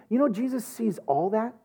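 ordinary talk, nothing marked

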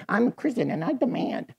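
mock entitled demand. Mocks an entitled believer demanding.

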